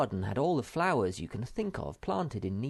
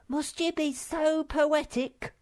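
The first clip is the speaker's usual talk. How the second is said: very high, slow, needling little creature; squeaky Sniff; 5 dB louder, slower than usual. Acted. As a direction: tease him in a tiny high voice. Sniff teasing.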